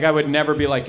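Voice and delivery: usual speaking voice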